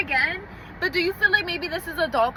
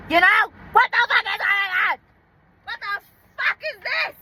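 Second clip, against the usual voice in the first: outraged shout; 8 semitones higher, 3 dB louder than usual. Real, not acted. Outraged rant about her family.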